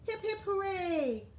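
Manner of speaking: high hip hip hooray; cheer. A narrator's reading. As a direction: cheer along with the class. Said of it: "everybody cheered".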